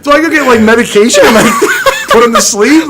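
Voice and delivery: mock panic while cracking up; laughing panic. His panicked thoughts, retold laughing.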